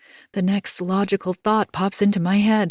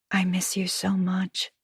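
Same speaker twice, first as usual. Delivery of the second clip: whisper; 3 dB quieter than usual. Acted. Reading her text, whispered.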